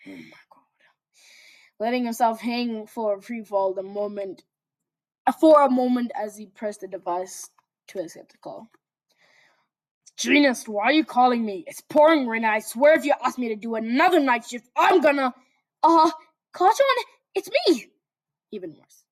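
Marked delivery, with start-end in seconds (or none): 0.1-0.6 breathy exasperated whisper. Real, not acted. 10.2-15.3 loud, irritated snarl; annoyed Katsuki. Acted. Grumpy hero answering call. 15.8-17.9 high, nervous meek voice; timid Deku. Acted.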